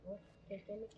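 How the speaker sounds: ordinary delivery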